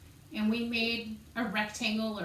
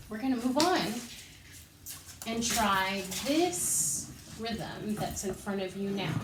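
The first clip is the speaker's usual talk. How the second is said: usual speaking voice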